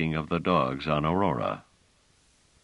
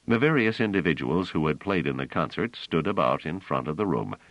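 usual speaking voice

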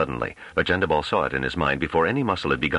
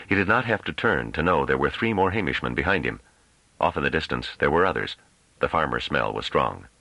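usual speaking voice

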